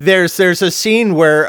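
usual speaking voice

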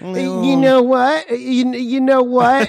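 put-on high, loud, drawn-out kid pestering; eager pestering kid. Mocking friend as a kid among older skaters, high and pestering. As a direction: pipe up like an over-eager kid. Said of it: "No, you'd be like".

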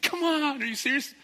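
very high, loud mock outrage; horrified listener. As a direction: play the shocked audience. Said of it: "horrified looking at me like".

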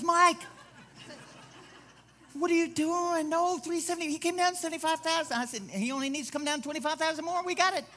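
realtor's voice jumps high and loud in panic; alarmed realtor. Retold or imitated. Realtor panicking as the pastor refuses to budge, shrill and loud. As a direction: squawk it like a frantic realtor. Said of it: "He goes".

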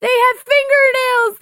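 high, loud, slow quoting of Juno; exaggerated teen movie girl voice; Juno impression. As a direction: mimic Juno's drawn-out line. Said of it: "you guys ever watch Juno when she's like".

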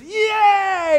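high, loud shout of joy; gleeful kid cheer. Retold or imitated. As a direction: cheer like a thrilled child. Kid joyfully jumping in manure.

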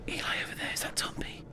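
pssst, whisper it sneakily; hushed, breathy stalking whisper; whispering to a partner while creeping up on a target; conspiratorial whisper. Acted. Sneaking up on Tumpy.

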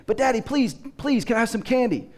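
put-on high, fast pleading little kid; lightly voiced little boy begging for candy; begging child. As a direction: beg like an eager small boy. Child begs again.

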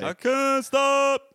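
slow, high, drawn-out singing in character; Sloth voice. Acted. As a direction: stretch every word out like Sloth from The Goonies. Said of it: "I won't do that voice too much".

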